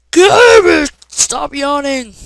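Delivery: very loud, high, slow shout; furious yell. Real, not acted. Gamer screaming at his character to stop yawning, loud and high. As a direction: blow up at the screen. Yelling at game again.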